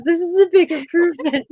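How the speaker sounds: high and laughing. Real, not acted. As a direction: laugh it out, embarrassed. Laughing at her own list.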